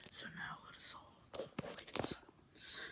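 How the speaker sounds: solemn unvoiced whisper; hushed whisper. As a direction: hush everyone for the countdown. Calls for silence before midnight.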